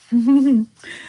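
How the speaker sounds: short giddy burst of laughter; bright real laugh over the new ring; delighted laugh. Real, not acted. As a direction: laugh out loud, pleased with yourself. Laughing about expensive ring.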